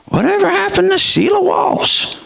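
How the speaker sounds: high, puzzled question; wondering aloud. Retold or imitated. Fan wondering where she went, puzzled. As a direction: pitch it up in wonder. Preacher voicing his wondering.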